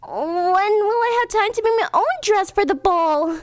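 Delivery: high, timid plea; timid Cinderella. Acted. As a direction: ask shyly about the ball. Cinderella asks meekly.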